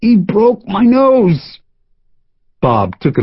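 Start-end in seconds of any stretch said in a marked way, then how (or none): 0.0-1.6 slow, high, whining moan; pained moan.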